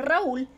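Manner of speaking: "Raúl" split as Ra, pause, úl; separated hiatus. Shown as a demonstration. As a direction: break "Raúl" slowly into two syllables. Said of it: "se deben separar".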